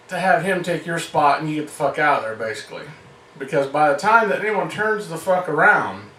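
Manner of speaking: ordinary talk, nothing marked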